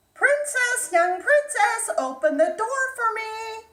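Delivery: high, loud frog calling through the door. Acted. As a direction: call up to her, insistent.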